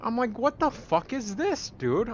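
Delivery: high, loud ranting yell; furious disbelief. Real, not acted. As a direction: shout your disbelief at the ending. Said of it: "I was so pissed".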